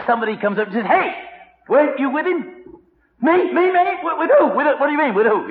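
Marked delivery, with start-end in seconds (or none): 0.9-2.6 high-pitched, sudden challenge; high, pointed questioning; accusing bystander. Acted. Acted accuser challenging Peter. 3.2-5.5 high, loud, stammered protest; hammed-up panicked bluffing; flustered denial. Acted Peter denying, flustered.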